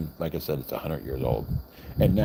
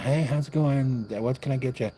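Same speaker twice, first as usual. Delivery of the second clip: waitress greeting; 8 semitones higher than usual. Retold or imitated. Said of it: "and she's like".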